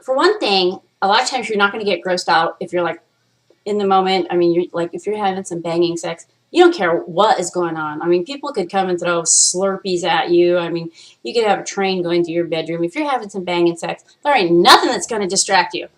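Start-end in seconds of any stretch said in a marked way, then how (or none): none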